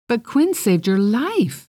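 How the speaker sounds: high, slow, flustered protest; indignant sputter. Acted. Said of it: "Lionel sputtered".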